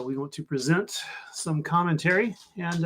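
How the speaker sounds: ordinary talk, nothing marked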